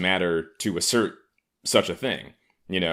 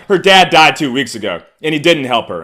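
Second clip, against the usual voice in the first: mock outraged; 8 semitones higher, 12 dB louder than usual. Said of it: "they're like".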